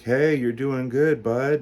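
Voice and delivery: hammed-up medical reassurance, drawn out; cheery doctor pep talk. Doctors cheer him on mid-surgery.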